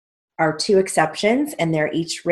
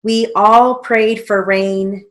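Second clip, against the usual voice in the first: slow dictation; 3 semitones higher, 5 dB louder than usual. Shown as a demonstration.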